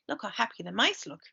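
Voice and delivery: plain reading voice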